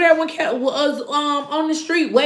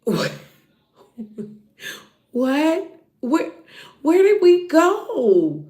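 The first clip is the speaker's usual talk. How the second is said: confused; 2 semitones higher, slower than usual. Retold or imitated. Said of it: "I just was like".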